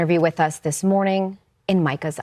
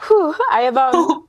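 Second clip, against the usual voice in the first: real tears, pitch high and strained; fighting back tears mid-interview; choking up; 9 semitones higher, slower than usual. Real, not acted. Anchor apologizing on air, choking up with tears. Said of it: "it's hard not to get choked up".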